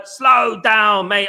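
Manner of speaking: SLOW DOWN stretched very long and loud; loud, hugely lengthened "slow down"; drawn-out slow down. Acted. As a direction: drag out each word of "slow down" emphatically. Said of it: "I can't slow down".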